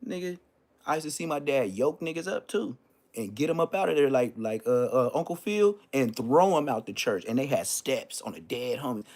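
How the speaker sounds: usual speaking voice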